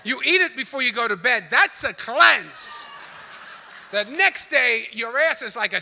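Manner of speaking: loud punchline. Acted. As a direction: hammer the punchline home. Italian cleanse recipe, shouted.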